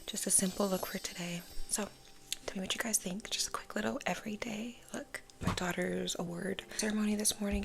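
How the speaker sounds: just woke up, still sleepy. Real, not acted. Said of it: "I have a very sleepy voice".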